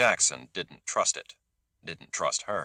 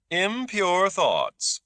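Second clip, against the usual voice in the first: mock shock; 13 semitones higher, 4 dB louder, much slower than usual. A narrator's reading. Landau's high, slow mock horror.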